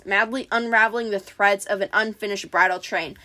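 plain reading voice

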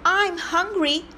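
high, slow little billy goat. Acted. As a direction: squeak it out like the smallest goat. Small goat's turn in story.

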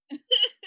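villainous cackle; gleeful evil chuckle; evil laugh. Acted. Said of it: "me rubbing my hands together evilly".

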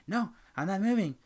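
imitating a customer who refuses to budge; stubborn customer. Stubborn customer quote retold.

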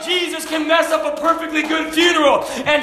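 high, loud pulpit proclamation, stretching key words; preaching at full force. Acted.

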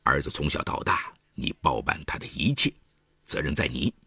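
low, cutting and blunt; pointed. A narrator's reading. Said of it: "一针见血地说".

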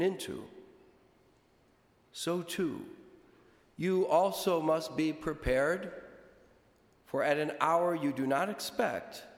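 solemn, measured liturgical reading; gospel reading. Acted. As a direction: proclaim it from the pulpit. Priest proclaims the gospel.

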